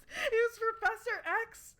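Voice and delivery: high, laughing cry of disbelief. Real, not acted. Gamer cracking up at the casting, high laughing disbelief. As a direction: crack up in shock.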